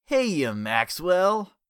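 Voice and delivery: loud, slow menacing villain call; villain greeting. A narrator's reading. Jellyfish surprising Richard from the back seat, loud menacing call. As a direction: drawl it out to startle him.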